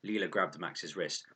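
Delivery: usual speaking voice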